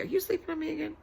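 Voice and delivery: usual speaking voice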